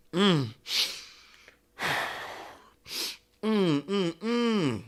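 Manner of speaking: soft stifled whimpering hums; holding back crying. Shown as a demonstration. Showing how the cry comes, mock stifled sobs. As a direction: act out fighting a cry with small, quiet moans. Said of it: "the cry comes, but it's like".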